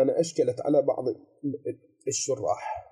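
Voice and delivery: normal talking voice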